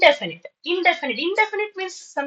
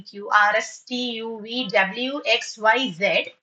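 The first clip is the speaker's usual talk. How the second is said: normal talking voice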